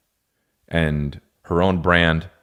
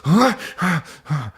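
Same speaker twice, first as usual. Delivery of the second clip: jerk up at each sound; hammed-up startled huhs; mock startled huh; 10 semitones higher than usual. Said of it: "you're like".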